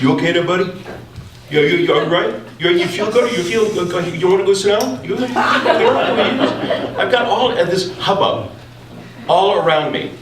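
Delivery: high, fussing granny voice; like a grandmother. Retold or imitated. Burly plumbers fussing over the rider like grandmothers. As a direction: coo like a worried grandma. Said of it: "talking like your grandmother".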